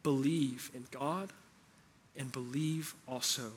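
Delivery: soft, slow, gentle voice of Jesus comforting; tender. Retold or imitated. Jesus comforting his worried disciples, soft and tender. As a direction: lay it down tenderly, like calming frightened friends. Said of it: "speaks with a tender heart".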